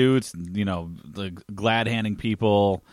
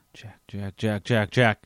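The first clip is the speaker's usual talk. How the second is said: very soft repeats swelling up to full voice; reversed echo; 2 semitones lower, 3 dB quieter, slower than usual. Retold or imitated. A woman's voice calling Jack in a nightmare, creepy building echo. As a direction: imitate a backwards delay creeping in. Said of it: "did a delay on the voice, but reversed it".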